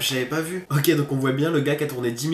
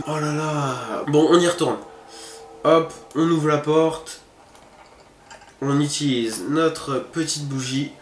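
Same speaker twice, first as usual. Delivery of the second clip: normal talking voice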